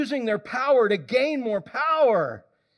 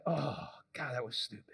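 low, soft groan of regret; rueful self-scold; 10 semitones lower, 12 dB quieter than usual. Pretend. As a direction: mutter it at yourself, embarrassed. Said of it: "you're like".

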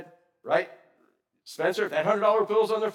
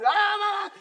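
high, loud mock shriek of a little sister; imitated little girl scream; 14 semitones higher, 4 dB louder than usual. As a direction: squeal it like the girl being teased. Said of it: "get her to scream".

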